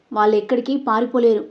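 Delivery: plain reading voice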